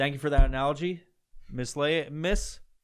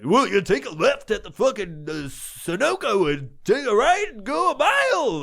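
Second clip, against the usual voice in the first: higher, louder announcer voice giving directions; radio DJ voice; 8 semitones higher, 7 dB louder than usual. Retold or imitated. Imagined stranger answering like a radio DJ, higher louder announcer voice. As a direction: give street directions like a hyped DJ. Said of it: "No one talks like that".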